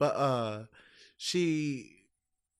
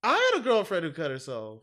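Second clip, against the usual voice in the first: mocking echo; 3 semitones higher, 7 dB louder than usual.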